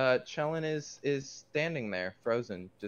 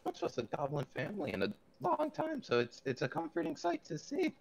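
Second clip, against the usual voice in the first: much higher, friendly and a bit awkward; friendly character voice. Acted. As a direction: play it high, nervous and overly friendly. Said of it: "and I'm like".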